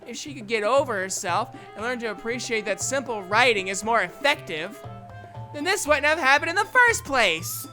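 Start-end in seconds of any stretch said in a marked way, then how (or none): 5.5-7.7 loud, high, exasperated complaint; heated argument. Acted. Natsuki arguing against convoluted poems, heated. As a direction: press the point forcefully. Natsuki argues her case angrily.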